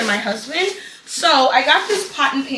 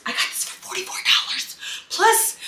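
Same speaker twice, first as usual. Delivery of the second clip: conspiratorial whisper, pitched up; 8 semitones higher, 4 dB quieter than usual. Real, not acted. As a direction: whisper the deal like a secret. Sharing the deal.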